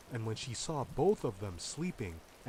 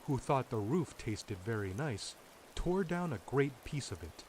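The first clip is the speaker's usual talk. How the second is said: ordinary delivery, nothing marked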